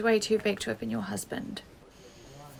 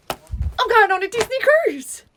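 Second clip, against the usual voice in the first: high, loud, slow squeal of joy; elated, drawn-out shriek; ecstatic announcement; 12 semitones higher, 11 dB louder, slower than usual. Acted. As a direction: shout it with sheer delight. Repeats honeymoon news with joy.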